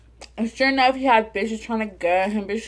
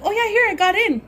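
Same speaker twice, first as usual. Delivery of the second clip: much higher, louder, sing-song gloat; mock-innocent brag to her boyfriend; taunting; 12 semitones higher, 4 dB louder than usual. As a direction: rub it in playfully. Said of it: "I'm like".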